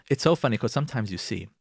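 normal talking voice

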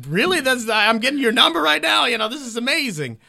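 high, giddy disbelief; can't believe your luck; thrilled. Retold or imitated. Comic thrilled to get Bill's number, high. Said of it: "And I was like".

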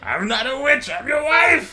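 put-on high shrieking old woman yell; Princess Bride old witch. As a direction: screech it like a cranky old wife. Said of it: "the old couple in, uh, Princess Bride".

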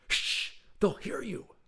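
high-pitched anxious hush; urgent shushing. A narrator's reading. Paranoid visitor hushing the inventor, with urgent shushing. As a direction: shush them, then warn at a high pitch.

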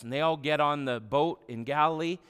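normal talking voice

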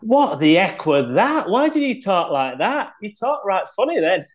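put-on puzzled mate in broad Leeds accent; strong Leeds accent. Steve baffled by his friend's switch, strong Leeds accent. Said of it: "he had a strong Leeds accent".

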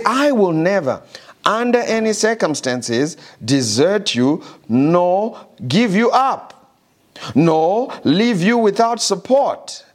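soaring preacher's cadence, dragging out never; preaching at full force. Acted. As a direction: proclaim every word like a promise from on high. Preaching God's promise.

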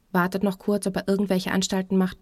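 ordinary delivery, nothing marked

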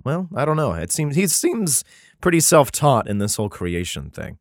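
usual speaking voice

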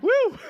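high loud whoop; excited whoop. Real, not acted. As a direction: let out a playful cheer. Exclamation after joke.

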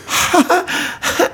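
high mock "ha, ha, ha"; imitated laugh. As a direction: fake an audience laughing. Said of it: "All people want when they come to comedy clubs".

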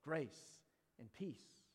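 hushed, very slow benediction; gentle blessing. Shown as a demonstration. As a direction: say it tenderly to someone who hurt you. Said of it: "out loud say the words".

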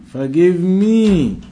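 ordinary talk, nothing marked

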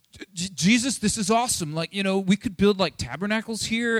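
imitated breathless-with-ideas excitement; excited Peter. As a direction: stammer into eager enthusiasm, acting out an awed disciple. Acting out Peter's awe at transfiguration.

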